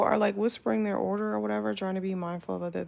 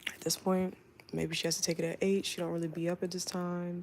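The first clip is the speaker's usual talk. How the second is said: ordinary talk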